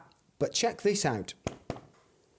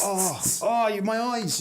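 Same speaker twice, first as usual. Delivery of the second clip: mock pain; 4 semitones higher, slower than usual. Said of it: "we'll act it out now".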